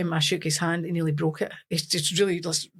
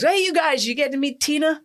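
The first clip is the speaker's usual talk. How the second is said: higher, breezy interviewer impression; pitched-up mock interviewer; American press man; 7 semitones higher than usual. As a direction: imitate an upbeat reporter on the line. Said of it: "And this guy's".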